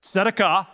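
teacher's clear TSEH-da-kah for "Tzedakah"; model pronunciation. Shown as a demonstration. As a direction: demonstrate "Tzedakah" with an opening TS. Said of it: "listen to me say it one more time".

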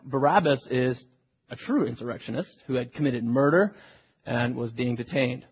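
ordinary talk, nothing marked

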